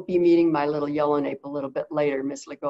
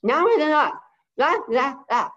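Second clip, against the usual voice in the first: high, loud mock parrot babble; 7 semitones higher, 6 dB louder than usual. Owner mimicking her parrot's babble, loud and high. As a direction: mimic the bird's garbled chatter. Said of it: "she would go".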